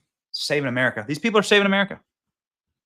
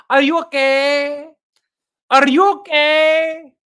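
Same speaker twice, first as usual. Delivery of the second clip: high, slow, stretched fake Mexican accent; mock Mexican accent; 6 semitones higher, 5 dB louder, slower than usual. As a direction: imitate someone overdoing a Mexican accent, dragging the last vowel. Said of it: "starts speaking in a Mexican accent".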